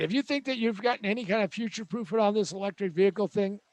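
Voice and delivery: ordinary delivery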